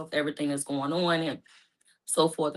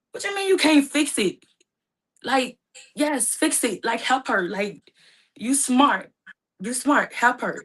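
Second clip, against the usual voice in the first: pleading; 6 semitones higher, 4 dB louder, slower than usual. Retold or imitated. Brother begs her to fix it.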